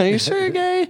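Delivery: very high, hesitant questioning; doubtful mom. Retold or imitated. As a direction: imitate a mother in squeaky disbelief. Retold mom doubting the coming out.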